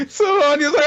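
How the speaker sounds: normal talking voice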